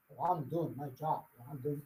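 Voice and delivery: soft, self-satisfied mumble, mocking a lazy lifter; lightly mock a gym slacker, murmured low; mock smug mumble. Coach mimics a slacker lifter to his agents, soft smug mumble.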